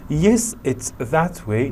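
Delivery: that said with a falling push; Yes, it's that way with that stressed. Shown as a demonstration. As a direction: lean on the word that. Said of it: "Notice the stress on that".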